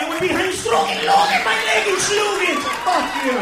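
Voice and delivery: loud, high shouted tirade; acted full-force angry shouting; furious rant. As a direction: yell the waiter down. Furious comeback to French waiter.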